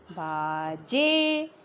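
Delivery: low, very slow बाजे with long vowels; sounding out. Shown as a demonstration. Teacher showing pupils the joined word, बाजे sounded out slowly. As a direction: hold each syllable of बाजे. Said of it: "sound के साथ बोल बोल के join".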